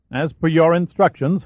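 curt and mildly put out; testy. A narrator's reading. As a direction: bite off the words with irritation. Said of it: "Finn said testily".

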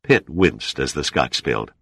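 ordinary delivery

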